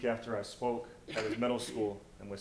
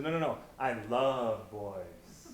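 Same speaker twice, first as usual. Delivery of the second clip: louder and drawn out, she insists; slow insistent declaration; 8 dB louder, much slower than usual. Retold or imitated. Girl at the mic insisting to the laughing gym, slow and loud. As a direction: stretch every no out, loud and sure.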